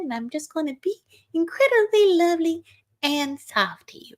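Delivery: high sugary mock-sweet voice; Angela. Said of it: "And she's like".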